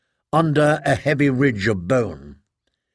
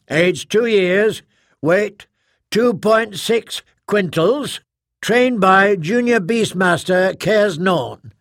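booming arena announcer; 6 semitones higher than usual. Acted. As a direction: call the fighter into the arena. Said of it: "the thunder of his magnified voice boomed".

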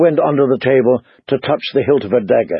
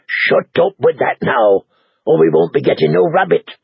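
warning; 3 semitones higher than usual. Acted. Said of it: "Lem warned him".

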